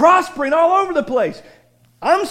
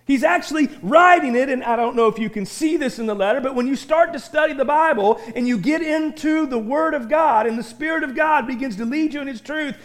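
normal talking voice

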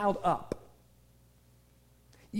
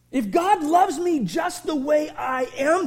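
ordinary delivery

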